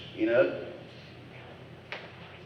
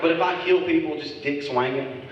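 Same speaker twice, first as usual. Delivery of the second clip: ordinary talk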